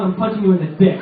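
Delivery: normal talking voice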